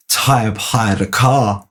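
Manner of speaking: slow, deliberate deadpan; Adam drops the news slowly; slow announcement. Retold or imitated. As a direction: lay it out slowly, word by word. Adam's deadpan announcement retold.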